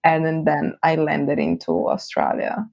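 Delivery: ordinary talk, nothing marked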